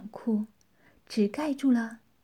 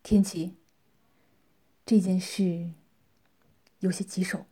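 grave low voice; 3 semitones lower than usual. A narrator's reading. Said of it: "沉声说道".

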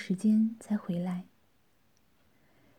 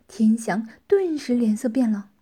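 usual speaking voice

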